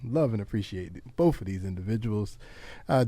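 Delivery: usual speaking voice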